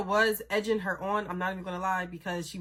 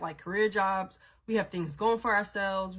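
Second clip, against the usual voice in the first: usual speaking voice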